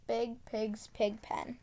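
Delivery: ordinary delivery, nothing marked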